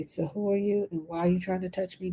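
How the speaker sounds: normal talking voice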